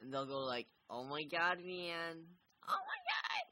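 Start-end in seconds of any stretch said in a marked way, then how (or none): 0.9-2.2 higher dude exclamation; second take of the dude line. Shown as a demonstration. Trying out voices for the dude, second take. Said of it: "And then I'll go to, like". 2.7-3.5 high cracked shout of oh my god; cry-shout. Shown as a demonstration. Trying out voices for the dude, shrieking take. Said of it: "And then I'll go to, like".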